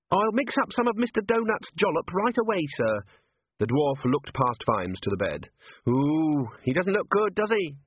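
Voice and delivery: put-on a keen young dwarf eager to help; Cheery the dwarf fussing over the patient.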